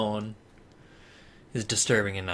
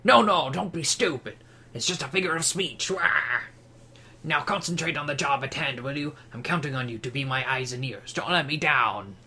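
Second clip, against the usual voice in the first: bossy cartoon villain voice; Waluigi; 2 semitones higher than usual. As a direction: dismiss and give orders like a cartoon schemer. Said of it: "Let's give him Waluigi voice".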